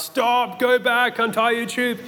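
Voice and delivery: high, urgent mock call from the riverbank; retold shout from the bank. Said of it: "people on the riverbank shouting out".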